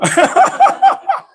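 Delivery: sudden piercing laugh of delight; high shrieking laugh. Real, not acted. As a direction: burst into a high loud cackle. Laughs loudly.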